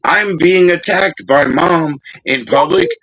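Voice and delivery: a hammed-up child protest; mock whiny kid. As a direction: play the wronged teen, hands up. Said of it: "hands in the air".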